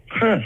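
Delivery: "Huh" pitched high as a puzzled question. Retold or imitated. Women not recognizing him, "Huh" as high blank question. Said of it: "With a question mark".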